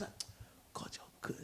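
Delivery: very soft, voiceless murmured prayer; whispering. Retold or imitated. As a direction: imitate a preacher's sudden whisper. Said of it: "I was doing that while I was driving".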